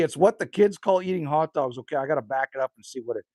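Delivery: usual speaking voice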